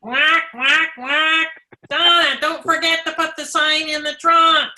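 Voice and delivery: high cartoon duck squawk; Donald Duck voice. Shown as a demonstration. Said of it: "you do a great Donald Duck voice".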